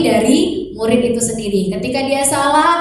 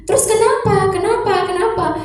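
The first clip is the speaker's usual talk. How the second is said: higher, patient repeated questioning; pressing mother; 8 semitones higher than usual. Retold or imitated. Mother keeps asking son why, higher voice. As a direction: keep asking why, gently.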